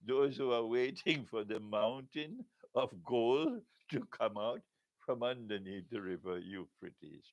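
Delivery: normal talking voice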